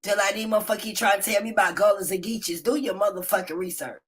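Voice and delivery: ordinary talk, nothing marked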